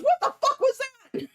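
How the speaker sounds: high, loud laughing yell; startled shout. Retold or imitated. Actor startled by the cannon on stage, shouting. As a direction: blurt it in shock at the cannon. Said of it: "the dude goes".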